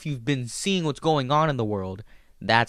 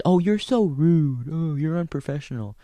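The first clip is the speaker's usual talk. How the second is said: mock offended critics; 4 semitones higher than usual.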